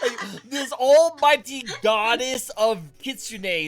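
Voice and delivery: loud, slow, laughing disbelief; excited. Real, not acted. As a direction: stretch it out in amazed glee. Marvelling at the allergic goddess.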